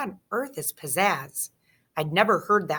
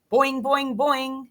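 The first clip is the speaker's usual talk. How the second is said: higher springy boing sound; frog boing twang; 6 semitones higher, slower than usual. A narrator's reading. Frog Og boinging back at the hamster.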